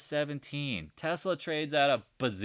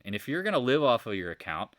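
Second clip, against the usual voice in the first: normal talking voice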